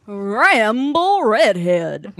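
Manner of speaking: slow, loud drawn-out name; impression of a man's voice. Shown as a demonstration. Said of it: "Do you wanna do his voice, though?".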